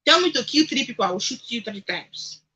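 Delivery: ordinary delivery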